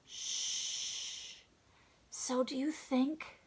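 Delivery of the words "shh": long soft shh; shushing. Acted. Gorilla with finger to his mouth, a secretive long shush. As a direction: hush someone with a drawn-out shh. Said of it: "has his finger up to his mouth like this".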